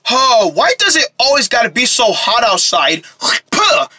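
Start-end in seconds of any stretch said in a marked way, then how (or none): 3.5-3.9 sharp, high spit sound; spitting. Acted. Boy practicing distance spitting, spitting sound. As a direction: spit it out hard. Practicing how far he spits.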